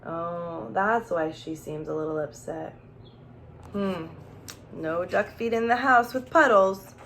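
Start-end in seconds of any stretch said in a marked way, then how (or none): none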